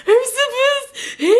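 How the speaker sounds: high, slow, tearful wail; reenacting a sobbing breakdown in the car; crying meltdown. To Gideon in the car, a slow tearful high wail. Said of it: "like, I just flipped out".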